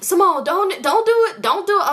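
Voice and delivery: urgent, high, repeated warning; high-pitched mock pleading. Man heading off an objection from his passenger, high mock pleading.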